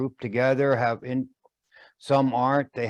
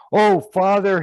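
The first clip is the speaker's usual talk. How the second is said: son's plea; 8 semitones higher, 8 dB louder than usual. Acted. Son answers father.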